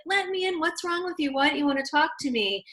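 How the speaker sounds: high mock plea through a locked door; pleading wife. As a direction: beg him to open up, hurt. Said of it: "And then she's like".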